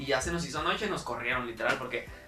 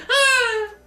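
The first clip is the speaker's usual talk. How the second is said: short, much higher, loud yelp; a sudden scared yelp; mock scream; 9 dB louder than usual. Recalling the drop, sudden high mock yelp.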